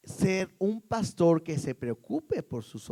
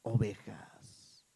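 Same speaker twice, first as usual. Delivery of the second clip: slow, breathy near-whisper; hushed command; 7 semitones lower, 5 dB quieter, much slower than usual. Acted. Jesus entrusting his sheep to Peter, hushed and slow. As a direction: entrust it very low and softly, drawn out. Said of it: "Y Jesús le dijo".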